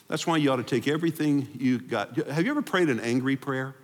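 usual speaking voice

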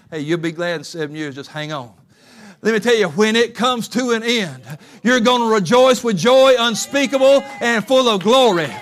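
loud, high, rousing sermon voice; preaching at full force. Acted. Preacher urging the congregation to go back, loud and rousing.

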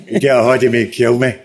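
ordinary talk

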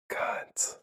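close-mic whisper, right in your ear; ASMR whisper. Shown as a demonstration. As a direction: breathe it right into the microphone. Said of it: "get close to the microphone and whisper it".

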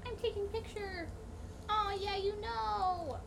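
high, soft cooing. Real, not acted. Friend coaxing the pet for a photo, soft high baby talk. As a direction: coo gently at a pet.

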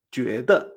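觉 rising, then 得 short and unstressed; second plus neutral tone. Shown as a demonstration. Teacher joins both syllables, 觉 rising and 得 short. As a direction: lift 觉 and tack 得 on briefly. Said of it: "el tono neutro".

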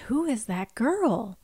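slow, mildly bemused wondering. Retold or imitated. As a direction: draw it out with soft puzzlement. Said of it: "in a bemused sort of way".

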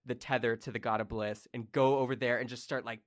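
ordinary talk, nothing marked

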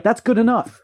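high, dismissive mock voice; mock president. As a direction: brush it off like a president. Voicing Lyndon Johnson.

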